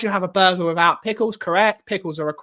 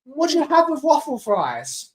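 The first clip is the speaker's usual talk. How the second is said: ordinary talk, nothing marked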